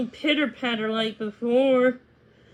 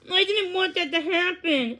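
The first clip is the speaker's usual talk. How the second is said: tearful; 5 semitones higher than usual. Acted. Daisy confessing to Millie, close to tears. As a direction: voice catching with tears.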